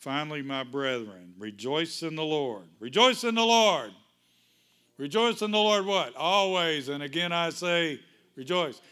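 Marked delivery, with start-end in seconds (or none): 2.8-3.9 higher and much louder, hitting REJOICE; preaching shout. Acted. Pastor rousing the congregation, a full preaching shout. Repeats verse with full force. 5.0-8.8 higher and louder, calling for an answer; preaching call. Acted. Pastor calling the congregation to respond, loud.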